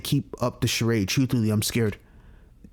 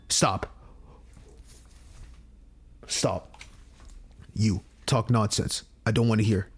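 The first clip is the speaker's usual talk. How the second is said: cold hero; 4 semitones lower, 4 dB quieter than usual. Acted. Voicing cold Justin lines.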